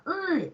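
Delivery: "uh" pushed out with throat pressure; squeezed Russian vowel on "uh"; pressed vowel. Shown as a demonstration. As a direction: say E but press it into "uh". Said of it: "with pressure".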